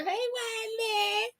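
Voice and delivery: very high, drawn-out squealed hello; mock fan calling out slowly in a high, soft voice; high sing-song greeting; acted fan greeting. Acts out greeting while being photographed.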